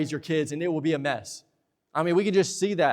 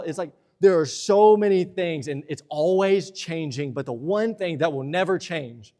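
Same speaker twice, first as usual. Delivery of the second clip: ordinary talk, nothing marked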